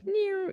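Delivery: very high-pitched "nyow" zap noise; mouth-made lightning sound, very high; imitated lightning zap.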